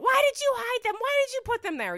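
a retold tantrum, pitched far up; screaming kid. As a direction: wail it like a frantic kid who lost his toys. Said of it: "He's screaming".

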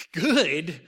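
loud, incredulous echo; exclaimed in disbelief. A narrator's reading. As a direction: throw the word back as a startled question. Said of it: "Muggsy exclaimed".

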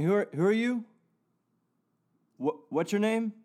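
ordinary delivery

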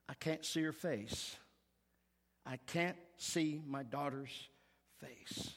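softly retelling a mother's tearful words; a quiet, breaking retold plea. Preacher retelling a grieving mother at the monitor, hushed and tearful. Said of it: "she began to cry".

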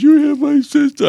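very high, whimpering mimicry; mock whine. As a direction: mimic a scared kid's squealed accusation. Mocking the cowering kid's accusation.

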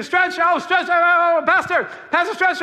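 put-on shrill call of excited kids; screaming kids. Kids at the park call for Pastor Stretch, shouting. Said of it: "all these kids are screaming".

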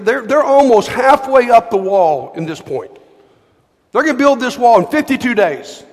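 ordinary talk, nothing marked

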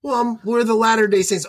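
mock the nervous young missionary; a hint of a timid door-to-door missionary. Missionary voiced in retold story.

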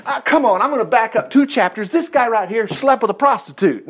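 usual speaking voice

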